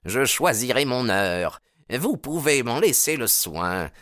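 haughty promise of revenge; threatening rival. A narrator's reading. Baldwin refusing to dirty his hands, threatening.

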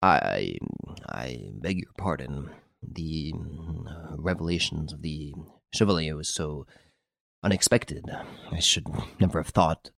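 a lightly voiced tremble, words faltering and dropping away; quiet, hesitant, shaken apology; trembling voice. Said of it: "in a trembling voice".

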